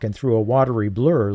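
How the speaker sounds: ordinary talk, nothing marked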